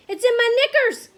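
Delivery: loud panic, high at first, then dropping in pitch; screaming. A narrator's reading. Cook pleading for help with the mouse, screaming. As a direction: beg for rescue at full voice. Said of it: "the man was screaming".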